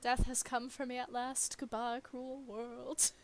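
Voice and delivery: melodramatic goodbye to the world; over-the-top fake doom; hammed-up dying. Hammed-up death scene.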